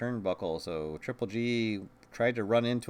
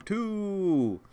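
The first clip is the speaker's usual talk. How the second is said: excited shout; 9 semitones higher, 4 dB louder than usual. Acted. Calling the kick-out count.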